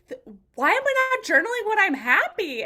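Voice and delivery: high, loud dawning surprise; sudden realization. Retold or imitated. Client telling her coach about journaling, a high surprised realization. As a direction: voice the client's aha moment.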